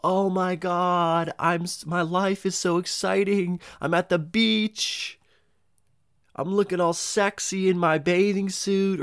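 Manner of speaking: high, slow, gushing show-off voice; sugary mock brag about the beach; mock influencer. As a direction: ham up a bragging influencer. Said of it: "everybody makes it look like their lives are so fucking exciting".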